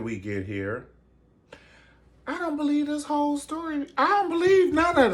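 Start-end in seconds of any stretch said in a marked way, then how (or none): 2.3-5.1 high squeal. Acted.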